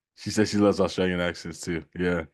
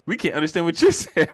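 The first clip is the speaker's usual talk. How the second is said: higher, louder, breaking into laughter; laughing punchline; 9 semitones higher, 6 dB louder than usual. Acted. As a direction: crack up while acting out the line. Laughing through own joke's punchline.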